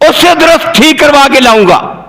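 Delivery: high, emphatic preaching pitch; declaimed promise. Acted. Preacher voicing the Prophet's promise to Gabriel, declaiming. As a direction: proclaim the promise from the pulpit.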